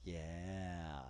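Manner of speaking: home-made sound effect echo; fake echo effect. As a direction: play your own echo, fading out. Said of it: "when you don't have goddamn technology to put in sound effects".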